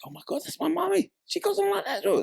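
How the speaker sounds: put-on high excited kid voice; kids imitating. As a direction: squeal it like a thrilled child. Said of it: "I mimicked my mum as well and my sister".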